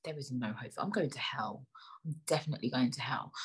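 low, hushed self-reproach; whispered aside. Real, not acted. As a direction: say it under your breath to yourself. Aside after her own innuendo.

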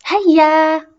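high, loud, eager shout; excited cheer. A narrator's reading. Boy to mother before first adventure, excited shout. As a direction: cry out, raring to set off. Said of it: "رفع يده يوسف بحماس وقال".

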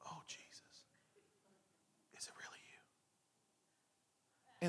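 breathless whisper of disbelief; awed whisper. Acted. Disciples whispering to Jesus in fearful awe. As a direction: whisper as if afraid to believe.

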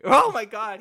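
loud, high burst of disbelief; shocked exclamation. Real, not acted. Checking a fact and finding it true, shocked loud outburst. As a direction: blurt it out in shock.

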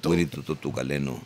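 put-on low, unhurried cartel boss in accented English. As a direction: speak low like a drug lord talking business. Guest plays narco boss in English.

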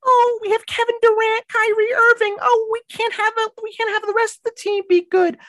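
very high whiny mock voice of an entitled fan; mocking Nets fans. Mocking Nets fans to his co-host in a high whiny voice. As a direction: whine it up high like a spoiled brat. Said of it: "such entitled little brats".

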